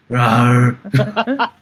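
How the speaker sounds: laughing half-hearted rawr; dinosaur voice. Pretend. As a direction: giggle through a weak roar. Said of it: "Can you please give us your roar".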